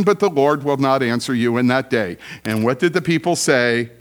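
ordinary talk

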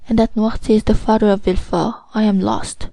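usual speaking voice

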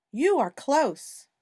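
excited hint. Pretend. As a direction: tease the child who is nearly there. Hot-and-cold hiding game.

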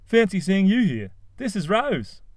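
high, chirpy Cliff voice; bright breezy introduction; beaming Cliff. A narrator's reading. Cliff introducing Rose to his mum, cheerful.

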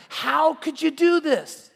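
high, slow, stretched-out alarmed cry; alarmed sailors. Acted. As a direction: drag it out in shocked disbelief. Frightened sailors confront Jonah.

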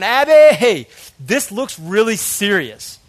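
calling out to Abby in alarm. Retold or imitated. Husband calling to his wife over the test results, alarmed and slowed.

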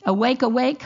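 normal talking voice

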